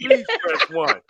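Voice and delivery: squeaky automated voice. Retold or imitated. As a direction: repeat the menu in a silly high voice. Automated menu repeating itself.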